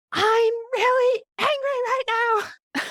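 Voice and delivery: high, loud yell of frustrated anger, drawn out slowly; angry yell. Retold or imitated. Mother yelling at her daughter after movie night, slow high angry yell. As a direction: yell it out, fed up but naming the feeling. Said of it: "but I yelled".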